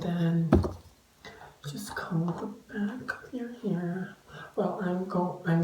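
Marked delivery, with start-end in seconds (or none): 1.7-4.2 soft whispered instruction; hushed tutorial tip. Real, not acted. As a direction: whisper it confidingly.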